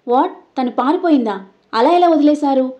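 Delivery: can't believe she got away; shocked question. A narrator's reading. Dev on the phone, shocked, questioning the guard. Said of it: "షాకింగ్గా అడిగాడు".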